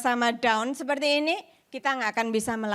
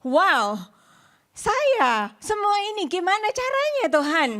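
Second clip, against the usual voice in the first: higher-pitched, astonished plea; overwhelmed amazement; 6 semitones higher than usual. Retold or imitated. Speaker recalls asking God how this could happen, overwhelmed amazement. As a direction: re-enact being stunned and asking how. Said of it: "saya sampai gini".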